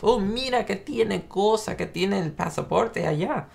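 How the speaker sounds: high-pitched imitation of an exclaiming Spanish speaker; Ricky's Spanish. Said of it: "Ricky with his Spanish".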